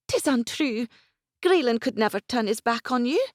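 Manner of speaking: put-on high, anxious young woman, shaken; young woman's voice. A narrator's reading. Isabel protesting.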